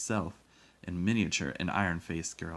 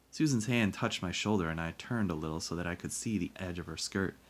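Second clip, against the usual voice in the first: usual speaking voice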